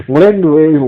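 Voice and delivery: ordinary talk, nothing marked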